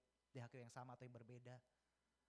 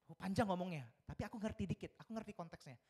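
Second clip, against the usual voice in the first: excited; 7 semitones higher, 11 dB louder than usual. Real, not acted. Excited at understanding child's Korean.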